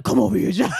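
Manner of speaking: playful shouted summons; mock commanding talk. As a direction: call him over with a mock order. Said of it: "I don't get that talk".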